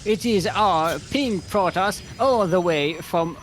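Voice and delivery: badly faked Aussie accent, drawn out; botched Australian accent. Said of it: "my Australian accent just turns Cockney".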